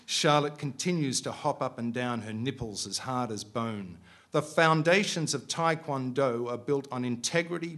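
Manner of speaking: steady, measured reading of a novel; story reading. A narrator's reading. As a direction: read it like an author at a bookshop. Author reads novel aloud.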